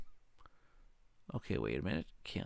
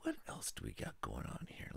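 hushed, thinking aloud; whispering; 11 semitones lower, 16 dB quieter than usual. Real, not acted. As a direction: whisper it to yourself. Muttering while planning a move.

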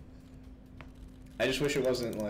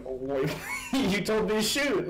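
higher, slow, laughing through it; laughing protest; 8 semitones higher, 3 dB louder than usual. Retold or imitated. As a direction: protest while cracking up. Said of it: "I'm just like".

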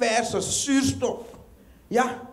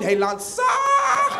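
very high, slow, laughing squeal on zacht; squealing mock praise; 12 semitones higher, much slower than usual. Comic mocking fans' over-the-top praise of a pilot, squealed high. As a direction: stretch it out high in mock awe.

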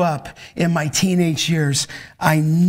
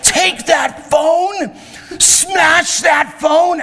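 high, drawn-out yell at a crowd; intense shouting; 12 semitones higher, 4 dB louder, much slower than usual. Retold or imitated. Son preaching to five hundred men about phones, intense shouting. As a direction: bellow each order slowly, full force. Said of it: "how intense he was that night".

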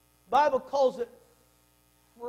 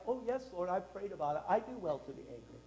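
soft, meek pretend piety; mock pious; 4 semitones lower, 9 dB quieter than usual. Jonah pretending to be meek before the Lord, softly. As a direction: say it sweetly, like it's holy. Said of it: "You don't say that like".

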